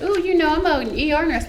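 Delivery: high, cooing "ooh" of pleasure; pleasantly delighted. Real, not acted. Nurse offered a goodie bag, pleasantly delighted.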